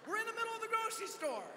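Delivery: high, fast, flustered protest; flustered panic. Retold or imitated. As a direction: plead in a rising fluster to stop the scene. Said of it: "I'm freaking out a little bit".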